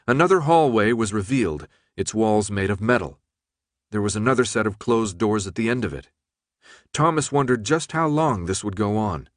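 ordinary delivery, nothing marked

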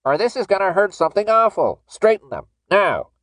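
high, loud urgent command; forceful warning. A narrator's reading. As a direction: order him sharply with a threat. Worker threatens pain, demands compliance.

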